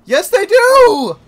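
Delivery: loud, high back-and-forth; shouted bickering. Real, not acted. Two friends bickering over game rules, shouting. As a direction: yell the point home.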